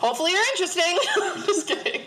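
high-pitched, laughing tease; teasing laughter. Real, not acted. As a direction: joke that breaks into laughter. Teasing listeners, then laughing.

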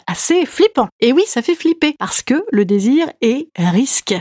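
ordinary talk

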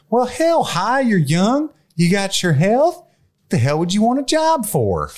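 high, slow, drawn-out country drawl. Retold or imitated. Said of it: "looks at Nick Cage and goes".

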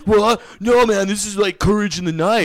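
Chad's high, loud, panicked protest; panicked mock yell. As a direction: freak out defending the tattoo. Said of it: "Chad just, like, freaks out".

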